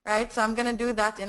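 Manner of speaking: usual speaking voice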